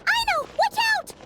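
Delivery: high, urgent call of warning. Acted. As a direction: shout a warning to a friend in danger. Warning a friend of danger.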